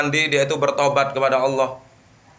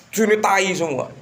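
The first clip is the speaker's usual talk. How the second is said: mock invitation; 5 semitones higher than usual. Voicing the man covered in filth.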